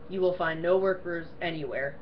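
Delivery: ordinary delivery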